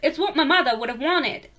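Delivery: put-on amateur Southern US accent for a character's speech. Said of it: "I don't know how southern people sound".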